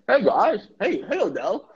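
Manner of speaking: hammed-up high dopey greeting; goofy swooping cartoon hello; goofy mad-Kitchens-disease voice. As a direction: greet them in a silly, high, addled voice. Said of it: "why are you talking like that".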